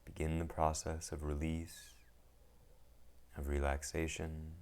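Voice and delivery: calm, unhurried meditation guide with long pauses; guided meditation. Acted. Teacher guiding listeners into settling the mind, calm meditation voice. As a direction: ease them into stillness, one phrase at a time.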